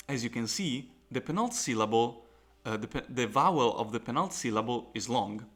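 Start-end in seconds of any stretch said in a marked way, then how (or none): none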